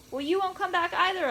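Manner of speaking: high, loud tearful protest; crying. A narrator's reading. Girl crying to her grandmother, high and loud. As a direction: wail it out through tears, pitch up. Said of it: "I cried even harder".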